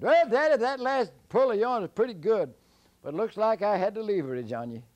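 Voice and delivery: high, loud, cocky voice; high bragging call; gloating Jack. Acted. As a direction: crow over the beaten giant.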